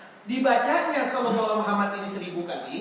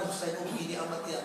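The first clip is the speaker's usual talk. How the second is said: soft, low murmur of acted venting; hushed confiding; 3 semitones lower, 8 dB quieter, faster than usual. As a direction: confide it under your breath, as if to one person. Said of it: "Kan lirih kan".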